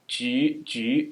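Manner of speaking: Ju said with rounded ü vowel, lips pushed forward; Mandarin ü sound. Shown as a demonstration. Teacher shows the ü after J to learners, drawn-out ü. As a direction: model Ju with the ü vowel. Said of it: "that U vowel sound".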